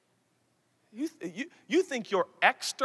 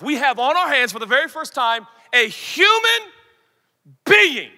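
loud, triumphant preaching; exultant proclamation; 4 semitones higher, 7 dB louder than usual. Real, not acted. Preacher announcing Adam's first breath, loud and exultant. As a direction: proclaim it like good news.

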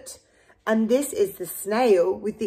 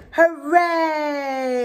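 higher joyful cheer; cheering shout; 6 semitones higher than usual. Acted. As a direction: cheer as the whale swims free. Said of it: "villagers shouting".

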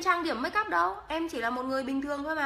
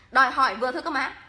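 fast, louder mock scold; louder, faster mock scolding of viewers; playful scolding; 7 dB louder, faster than usual. Real, not acted. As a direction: tell them off, half joking.

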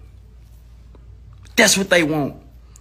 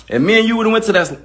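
ordinary talk, nothing marked

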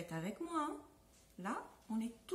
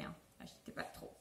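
whisper; 15 dB quieter than usual. Real, not acted. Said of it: "whispered aside".

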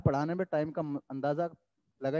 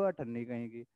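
muttered insult; 8 semitones lower, 11 dB quieter than usual. Real, not acted.

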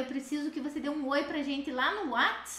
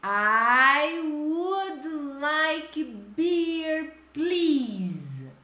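"I would like water, please" very slow and louder; slow loud model; 2 semitones higher, 3 dB louder, much slower than usual. Shown as a demonstration. Teacher modeling the answer for students, slow and loud. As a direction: say it slowly for the class to copy.

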